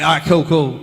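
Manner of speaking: put-on loud, casual Australian accent. Actor relaxing after "cut" on set, Australian accent. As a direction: snap back into easy Australian. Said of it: "straight to my Australian accent".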